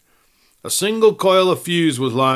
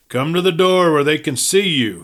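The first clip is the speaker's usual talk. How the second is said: called out; 4 semitones higher, 5 dB louder than usual. A narrator's reading. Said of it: "he called out to Sawtee".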